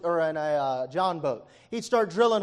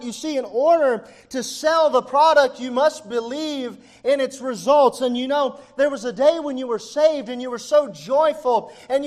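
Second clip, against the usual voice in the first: raised, high-pitched, driving sermon; fervent preaching; 7 semitones higher, 8 dB louder than usual. Real, not acted. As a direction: preach it at full force to the congregation. Preaches at full force.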